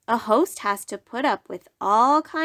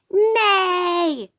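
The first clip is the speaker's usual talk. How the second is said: high, loud yell of nay; yelling crowd; 9 semitones higher, 4 dB louder than usual. Acted. The guests outvote the moose, high loud yell. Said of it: "they all yelled".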